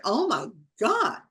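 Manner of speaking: high, slow astonished exclamation; retold amazement. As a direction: draw it out in wonder. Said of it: "It's like".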